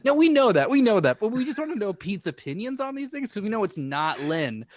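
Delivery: high, loud mock-earnest protest; playful insistence. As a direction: insist with exaggerated innocence.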